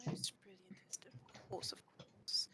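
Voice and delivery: whispered aside, no voice. Acted. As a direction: breathe it under your breath. Player whispers in character aside.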